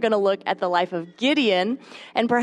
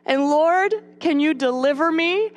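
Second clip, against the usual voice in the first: pleading prayer; 7 semitones higher than usual. Retold or imitated. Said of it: "we can feel like".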